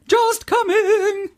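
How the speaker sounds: very high, very slow mock-posh warble; chimed in. Girl turning round majestically, chiming in very high and slow. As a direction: stretch it out like a majestic lady. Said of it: "chimed in".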